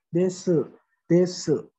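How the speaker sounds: "desu" with a weakened final u; softened ending. Shown as a demonstration. Teacher shows Japanese usage, "desu" softened at the end. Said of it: "dilembutkan di akhirnya".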